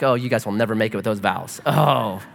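low, half-laughing mock lament; mock about to cry. Pastor privately despairing at a couple's vows, mock tearful. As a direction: pretend to be near tears, lightly. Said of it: "and I wanna cry".

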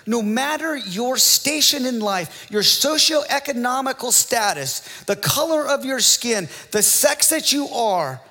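high, loud revival preaching; thundering pulpit call; full-force preaching. Acted. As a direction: build the list to a crescendo. Preacher builds to a climax.

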